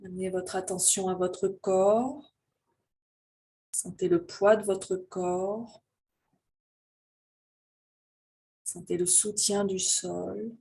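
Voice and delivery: slow, calm voice leading a breathing meditation; guided meditation. Real, not acted. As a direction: guide them gently into their breath, unhurried, drawing out phrase endings.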